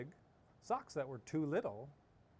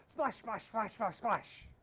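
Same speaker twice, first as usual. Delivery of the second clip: fast splashes; 7 semitones higher, 3 dB louder, slower than usual. A narrator's reading. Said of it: "Tina rowed fast".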